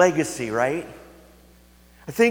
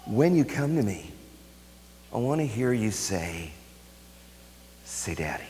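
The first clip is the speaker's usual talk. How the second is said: much lower and softer, fading into near silence; tender hush; 7 semitones lower, 7 dB quieter than usual. Acted. God speaking to his children, tender hushed voice. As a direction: coax it gently like a father to his child. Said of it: "The God of the universe says".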